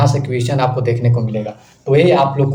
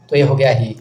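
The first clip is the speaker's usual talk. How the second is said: ordinary talk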